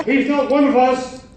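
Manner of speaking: usual speaking voice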